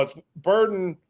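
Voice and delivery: normal talking voice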